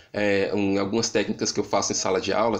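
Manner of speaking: ordinary talk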